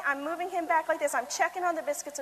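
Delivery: ordinary talk, nothing marked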